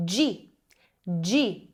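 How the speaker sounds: G said as "dgee"; teacher demonstrating the Italian gi; Italian gi sound. Shown as a demonstration. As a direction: sound out G clearly. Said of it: "vai ter som de".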